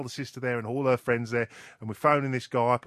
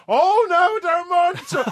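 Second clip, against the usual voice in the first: much higher, louder gushing voice; delighted prize winner; 9 dB louder than usual. Retold or imitated. Prank victim thrilled about publicity, gushing high voice. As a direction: gush like a thrilled dupe. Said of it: "We say to him now".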